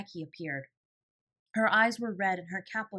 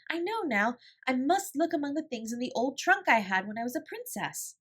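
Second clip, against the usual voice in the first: high, eager rush of joy; thrilled, the idea has arrived; elated discovery; 6 semitones higher, 4 dB louder than usual. A narrator's reading. Sarah's inspiration arrives.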